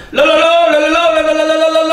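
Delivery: high sustained la-la babbling in imitation; mimicked tongues babble. Preacher imitating women praying at the hospital, high monotone babbling. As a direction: burst into mock babble on one held pitch. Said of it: "就开始".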